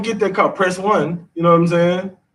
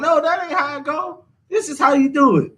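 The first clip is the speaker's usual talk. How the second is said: higher mock child's voice correcting a parent; know-it-all kid; 9 semitones higher than usual. Toddler correcting a parent on the phone, higher mock kid voice. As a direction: play the baby who knows the phone better. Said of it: "get to telling you when you doing something wrong".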